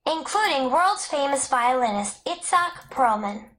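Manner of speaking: ordinary delivery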